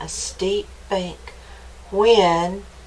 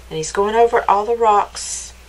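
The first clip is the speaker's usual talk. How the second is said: ordinary delivery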